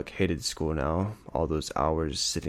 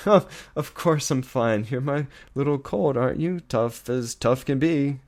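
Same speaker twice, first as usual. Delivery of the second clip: forced cheer; 8 semitones higher, 5 dB louder than usual. Acted. Father insisting to his son he's fine, forced bravado. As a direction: insist too brightly you're fine.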